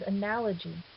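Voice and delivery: analogy stressed on its second syllable, a-NAL-ogy. Shown as a demonstration. Teacher showing how a lengthened word moves its accent, second-syllable stress. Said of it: "the accent is on a different syllable".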